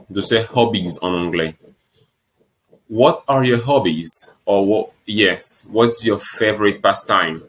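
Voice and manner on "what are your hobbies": ordinary delivery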